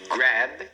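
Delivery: GRAB as a single syllable, stopping on the B; one-syllable pronunciation. Shown as a demonstration. Teacher names a one-syllable word, correct pronunciation. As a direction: say "grab" with no vowel after the B. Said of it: "é uma palavra de uma sílaba".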